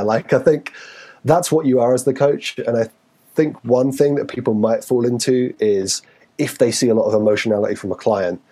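usual speaking voice

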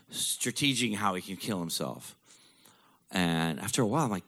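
ordinary talk

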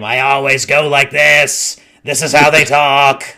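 loud, put-on monster voice; Chungus creature voice. Shown as a demonstration. Game master showing the players his monster voice, loud creature voice. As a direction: boom it out like a talking monster. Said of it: "my voice for, uh, all Chungus-like creatures".

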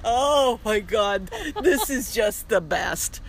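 a touch of crying, retelling her overjoyed reply to the worried diners; tearful joy. Retold or imitated. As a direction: sob it out from happiness. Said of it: "I am just sobbing".

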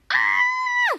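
very high, sustained "aah" scream; piercingly high but not full-volume scream; mock scream. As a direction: let out a high-pitched acted shriek. Cassie screams before cutting.

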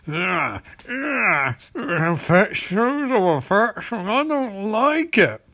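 slow, put-on creepy villain sneering; hammed-up disgusted villain. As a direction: ooze disgust like a lurking villain. Villain disgusted watching couple.

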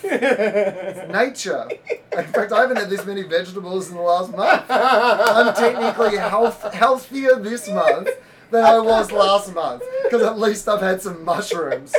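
high, loud and laughing through it; giggling at his own mushroom joke. Real, not acted. Joking to his co-host about eating mushrooms, laughing.

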